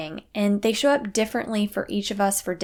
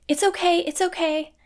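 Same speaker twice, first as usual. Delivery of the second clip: high, worried "It's okay, it's okay"; concerned parent; 8 semitones higher than usual. Retold or imitated. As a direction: fret over a fallen child, pitched high. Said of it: "we say it in a very concerning way".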